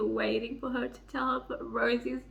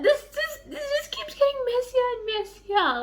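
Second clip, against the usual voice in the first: shocked, high-pitched; 11 semitones higher, 3 dB louder than usual. Real, not acted. Shocked by the twist.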